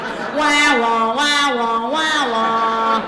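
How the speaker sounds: sister's ranting as a droning wah wah; mock wah wah. Sister's nagging heard as a mocking wah wah drone. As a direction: drone it like a car alarm going off. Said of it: "sounds like a car alarm".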